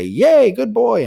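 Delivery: very high, loud, drawn-out slow praise; excited praise. Shown as a demonstration. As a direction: gush over the dog like it's a party. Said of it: "we're gonna say".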